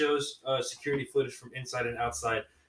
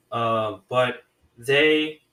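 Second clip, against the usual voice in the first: ordinary talk, nothing marked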